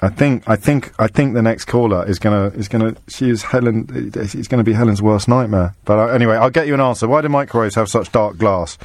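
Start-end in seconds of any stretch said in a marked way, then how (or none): none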